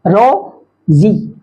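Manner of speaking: teacher's split reading of रो-जी; syllable by syllable. Shown as a demonstration. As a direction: separate the two syllables रो and जी. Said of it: "दो syllabic word हैं".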